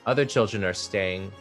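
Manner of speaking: normal talking voice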